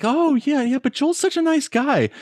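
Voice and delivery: high, gushing praise for a nice guy; lightly mock the fans gushing; playing naive fans' high fond voice; others' gushing voice. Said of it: "they were like".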